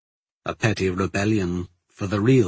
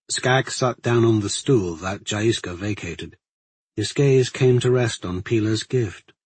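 ordinary delivery, nothing marked

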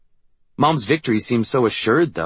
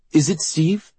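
high, sharp suspicious question; protective anger; 9 semitones higher, 4 dB louder, slower than usual. A narrator's reading. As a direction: demand it, suddenly riled. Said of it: "suddenly ready to break him in half".

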